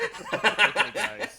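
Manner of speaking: laughing. Acted. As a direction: say it through laughter. Voicing character, laughing.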